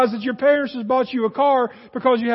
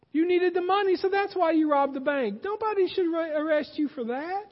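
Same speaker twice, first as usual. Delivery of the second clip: soothing enabler friend; 5 semitones higher, 4 dB quieter than usual. Retold or imitated. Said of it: "Even if you are dead wrong".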